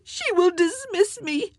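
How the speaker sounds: very high, tearful wail; anguished, high-pitched crying; piteous wail. A narrator's reading. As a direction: wail it in despair. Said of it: "rose to a piteous wail".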